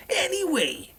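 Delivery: bright, high YouTuber sign-off; upbeat outro. Acted. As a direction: pitch it up for the outro.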